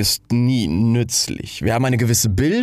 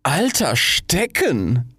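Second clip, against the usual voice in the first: very slow, higher, drawn-out echo; affronted; 6 semitones higher, much slower than usual. A narrator's reading. Rocket hearing itself called old stick, slow and affronted. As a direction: repeat it slowly in disbelief, pitched up.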